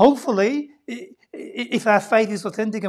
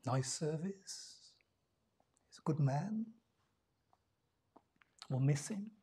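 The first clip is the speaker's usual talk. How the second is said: hushed inner thoughts; 4 semitones lower, 12 dB quieter than usual. Retold or imitated. Voicing thoughts at a funeral.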